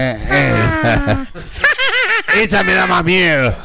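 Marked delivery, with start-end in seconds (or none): 1.2-2.4 high, shrieking villain cackle; evil laugh. Pretend. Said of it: "Esa es mi risa malvada". (risa malvada aguda y chillona)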